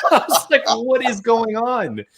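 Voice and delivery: high, slow laugh-through; laughing disbelief. Real, not acted. Telling of the AI's confession, laughing in disbelief.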